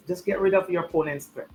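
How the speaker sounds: normal talking voice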